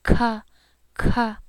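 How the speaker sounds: "k" with a puff like an English K; aspirated k. Shown as a demonstration. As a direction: blow air after "k". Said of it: "going to be aspirated".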